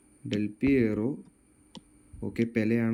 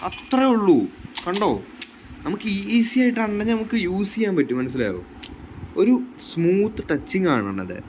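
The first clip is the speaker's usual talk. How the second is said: excited; 7 semitones higher than usual. Real, not acted. Praising the player after a goal.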